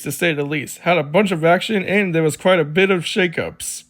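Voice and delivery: normal talking voice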